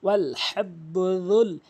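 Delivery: slow, drawn-out chanted recitation; Quran recitation. Shown as a demonstration. Tajweed teacher reciting the verse for students, slow and melodic. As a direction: recite it melodically, stretching every vowel.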